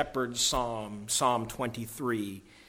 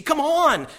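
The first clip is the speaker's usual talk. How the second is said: high and louder, urging; urgent; 13 semitones higher, 8 dB louder than usual. Acted. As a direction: pull your sister along. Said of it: "she turned, pulling Susan".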